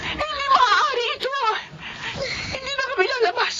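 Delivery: high, tearful, rushing then crying out; frantic to get the child to a doctor; panicked mother. Acted. Mother panics over sick child. (nataranta at umiiyak na ina)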